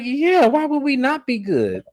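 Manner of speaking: high, slow puzzled voice of a confused friend; mock confused innocent. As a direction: ask it bewildered, pitched well up and drawn out. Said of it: "Angel is confused 'cause she was like".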